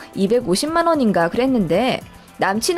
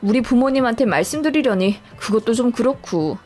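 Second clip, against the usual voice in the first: usual speaking voice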